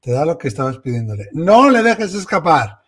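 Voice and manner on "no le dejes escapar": loud, higher-pitched exhortation; emphatic urging. Real, not acted. Host urging the caller not to lose her husband, emphatic. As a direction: urge it loudly, pitch lifted.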